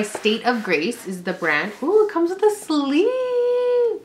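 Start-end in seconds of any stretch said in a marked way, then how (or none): 1.8-4.0 high, slow, drawn-out cooing; delighted coo. Real, not acted. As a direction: coo over it like a new toy. Delighted unboxing a water bottle.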